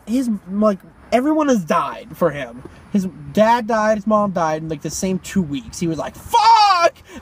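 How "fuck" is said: high, loud retold outburst; exaggerated cry of dismay; anguished mock yell. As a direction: imitate someone cursing their luck, high and loud. Uncle's reaction to parents dying.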